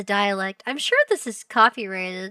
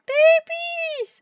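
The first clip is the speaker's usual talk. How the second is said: very high, loud squeal; excited squeal; 6 dB louder than usual. Real, not acted. Streamer delighted by a family baby picture, squealing.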